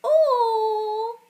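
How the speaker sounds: put-on high, loud, drawn-out toddler voice; squealing toddler. Toddler showing off to the young adult, high squealing voice. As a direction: coo loudly up high like a delighted toddler. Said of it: "the toddler's like".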